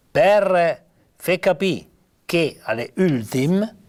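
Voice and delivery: scandito molto lentamente e più acuto; lento. Shown as a demonstration. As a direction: rallenta e scandisci. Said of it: "spiegazione scandita". (spiegazione lenta e scandita)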